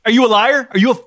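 high, fast, furious yelling. Acted. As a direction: scream at him down the phone. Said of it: "why are you yelling at me".

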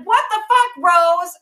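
high, loud mock outburst at his wife; shocked yell. Husband yells at wife over the salon, high shocked yell. Danny learns about the salon.

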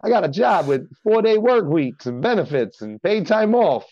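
high-pitched Italian-American sales spiel; Italian accent. Shown as a demonstration. Guest going in on his job spiel, high mock Italian-American accent. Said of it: "I just do this Italian accent".

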